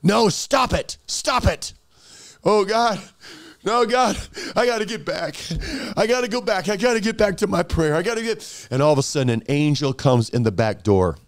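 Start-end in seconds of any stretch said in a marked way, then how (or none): 0.0-1.7 high, frantic protest; panicked demon. Acted. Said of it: "then the demon goes". 2.4-8.7 high, urgent pleading; desperate panic. Acted. Acted desperate return to prayer.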